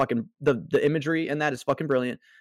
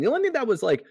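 ordinary delivery

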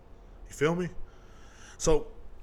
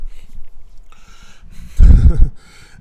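laugh; 14 dB louder than usual. Real, not acted. Laughing alone at his own joke.

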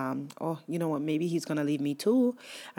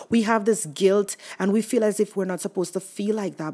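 usual speaking voice

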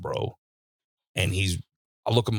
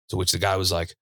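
ordinary talk

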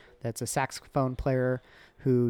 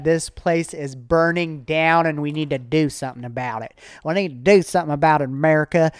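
Bill Nye impression; 3 semitones higher, 7 dB louder than usual. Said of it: "were you trying to do a Bill Nye accent".

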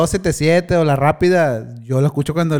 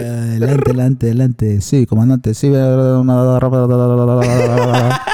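radio reply; 5 semitones lower, 4 dB louder, slower than usual. Retold or imitated. Answering as a radio operator.

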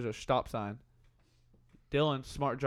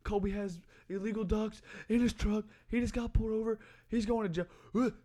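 higher-pitched mock panic; retold freak-out; 6 semitones higher, faster than usual. Panicked friend reporting the pullover, imitated freak-out. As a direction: mimic a nervous friend, pitched up. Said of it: "starts freaking out bad".

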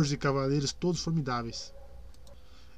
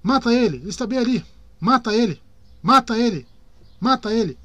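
loud high repeated shouts; shouting; 8 semitones higher, 9 dB louder than usual. A narrator's reading. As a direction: keep yelling from the stands. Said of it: "gritou de repente".

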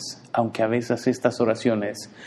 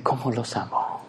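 soft, tender sigh of a loving God; sighing tenderly; 5 dB quieter than usual. Acted. God sighing over his fearful children, soft and tender. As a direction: breathe it out softly with love. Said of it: "me imagino que suspira y dice".

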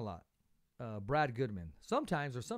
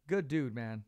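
normal talking voice

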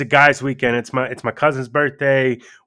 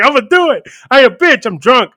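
higher and louder, hyped up; psyched drunk self-talk, higher and louder; drunk bravado; 8 semitones higher, 6 dB louder than usual. Retold or imitated. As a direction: pump yourself up drunkenly. Retelling his drunk bravado.

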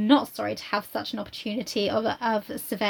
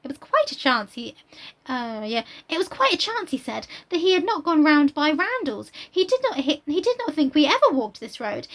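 put-on higher, breathless gushing girl; eager Harriet; 7 semitones higher than usual. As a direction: gush it out like an excited young girl. Reads Harriet's lines aloud.

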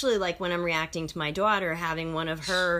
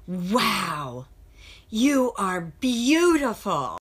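put-on child's compliment; 4 dB louder, much slower than usual. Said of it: "he looks up and he goes".

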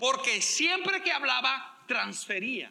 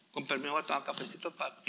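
quick, softer hurried brush-off; hurried excuse; 10 semitones lower, 7 dB quieter, much faster than usual. Retold or imitated. Pastor excusing himself from a stranger, lower and rushed. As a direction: rattle it off, lower and fast, to slip away.

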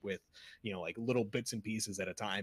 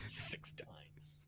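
laughing whisper; 8 dB quieter than usual. Real, not acted. Mocking the 'six dimes' line.

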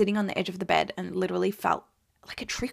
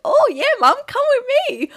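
much higher and louder, thrilled; delighted pregnant daughter gushing to her mum, high and loud; giddy excitement; 10 dB louder than usual. Retold or imitated. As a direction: squeal it like the star of the show. I felt like the center of attention.

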